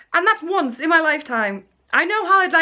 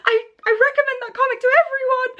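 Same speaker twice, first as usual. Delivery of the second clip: nervous laughing reply; 11 semitones higher, faster than usual. Retold or imitated. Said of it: "nervously laughing".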